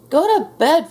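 high, loud, falling shout; shouted order. A narrator's reading. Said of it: "shouted Gus".

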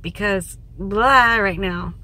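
ordinary talk

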